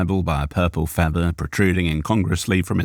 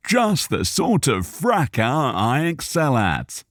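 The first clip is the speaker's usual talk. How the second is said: slow relishing gentleman; 7 semitones higher, slower than usual. A narrator's reading. Cal Asser voiced.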